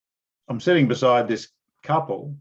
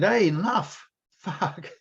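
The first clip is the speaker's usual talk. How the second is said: retold shout; 6 semitones higher, slower than usual. Breaking up a bouncer's assault.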